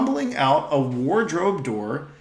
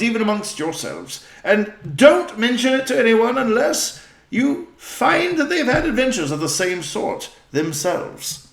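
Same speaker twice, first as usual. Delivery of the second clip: put-on higher, louder eccentric old professor; 7 semitones higher, 6 dB louder than usual. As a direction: muse and chuckle like a wise old don.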